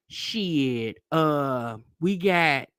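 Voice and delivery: low, drawn-out casual mumble of an employee; casual young cashier. Retold or imitated. Young cashier listing sides to a customer, low casual drawl.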